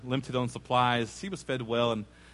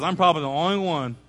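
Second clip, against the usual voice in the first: father's request; 2 semitones higher, 8 dB louder than usual. Retold or imitated.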